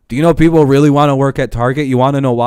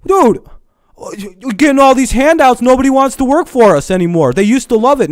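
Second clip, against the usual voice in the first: much higher, louder whining employer; whiny bosses; 12 semitones higher, 4 dB louder than usual. Retold or imitated. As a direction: whine like a spoiled boss who can't believe it. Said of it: "these people are going".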